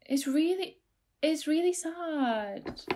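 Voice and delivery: high, soft, drawn-out lament; plaintive insistence. Real, not acted. Insisting to friends the monkey story upsets her, plaintive.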